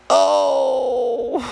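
long high "oh" of comic despair; exasperated groan. Real, not acted. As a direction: let out a high, drawn-out groan. Worn out by the silly caller.

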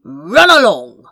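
loud, scornful brush-off; dismissive shout. Acted. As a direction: shout him away like a pest. Sorcerer shoos the hero away.

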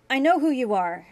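loud, high accusation; accusing. A narrator's reading. Grant accusing Julian at the door, loud and angry. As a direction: spit the accusation at him. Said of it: "Grant accused".

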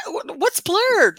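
high, slow baffled "what's Blurred"; mock confusion. First hearing about Blurred at the march, high and baffled. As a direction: stretch it out in disbelief.

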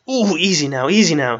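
high, loud protest at being hugged; pained shout. A narrator's reading. Injured Strider protests Arwen's hug, shouting. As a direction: cry out to make them let go. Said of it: "he shouted".

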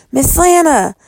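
calling into the dark shed. A narrator's reading. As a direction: call the name out, high. Calling for missing woman.

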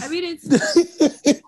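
words through a high burst of laughter; cracking up mid-sentence; laughing. Real, not acted. Breaks into laughter at joke.

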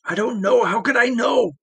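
high, frantic denial; desperate pleading. Acted. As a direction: plead helplessly. Tortured prisoner pleading.

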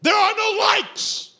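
loud, high revival shout; emphatic pulpit declamation; fired-up preaching. Real, not acted. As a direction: punch out each phrase with rising fervor. Fired-up sermon rant against "like.

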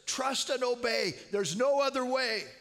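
loud, high, spoken refrain; loud repeated refrain. Retold or imitated. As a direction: repeat the words with rising fervour. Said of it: "she kept repeating those words".

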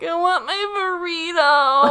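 high, slow whiny plea with a laugh; whiny drunk friend. Retold or imitated. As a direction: beg for the burrito like a toddler. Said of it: "talkative and whiny".

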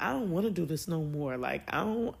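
low, soft, discouraged admission; insecure. Retold or imitated. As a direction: quietly give up, retelling a moment of self-doubt. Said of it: "I got so insecure".

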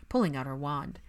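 ordinary delivery, nothing marked

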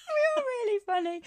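high laughing voice. Real, not acted. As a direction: laugh through the words. Laughing self-praise.

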